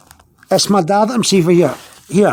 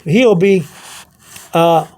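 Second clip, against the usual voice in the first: normal talking voice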